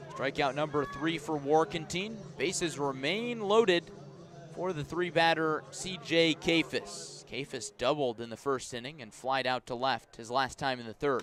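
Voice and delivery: ordinary talk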